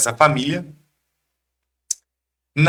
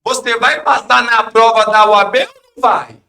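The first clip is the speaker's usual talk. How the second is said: yelling across the room; 7 semitones higher, 5 dB louder than usual. Retold or imitated. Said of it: "ele me gritou do outro lado".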